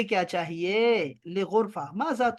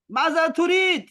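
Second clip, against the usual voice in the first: Maaza तुरीद asked higher and louder, demanding; different tone; 7 semitones higher, 5 dB louder than usual. Shown as a demonstration. Teacher showing a varied tone to students, higher demanding ask. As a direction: fling the question out sharply. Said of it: "लहजे मुख्तलिफ करके".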